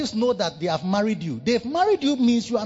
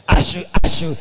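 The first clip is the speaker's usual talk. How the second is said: mock grudging voice; 4 semitones lower, 4 dB louder than usual. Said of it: "Then the voice comes from here".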